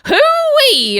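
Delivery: high, loud American country hoo-wee; American country whoop. Retold or imitated. Mimicking the American country host, high loud country whoop. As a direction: whoop like a cowboy. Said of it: "you're saying, like".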